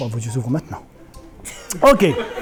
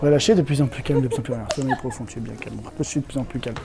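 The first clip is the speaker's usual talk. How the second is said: soft, low hypnotic suggestion; hypnotist voice; 6 semitones lower, 8 dB quieter than usual. Acted. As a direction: lull them into deep trance. Hypnotist puts subjects under.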